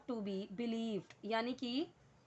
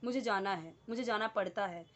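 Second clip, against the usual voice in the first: usual speaking voice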